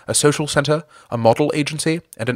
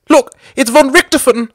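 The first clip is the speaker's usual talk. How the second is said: much higher, louder excited cry; cried excitedly; 14 semitones higher, 9 dB louder than usual. A narrator's reading. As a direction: point and shout in alarm. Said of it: "he cried excitedly".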